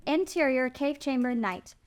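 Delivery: ordinary talk, nothing marked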